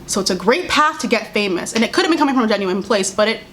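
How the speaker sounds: ordinary talk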